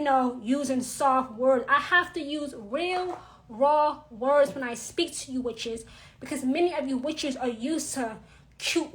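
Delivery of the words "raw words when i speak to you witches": usual speaking voice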